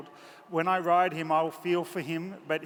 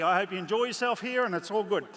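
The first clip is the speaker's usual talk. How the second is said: normal talking voice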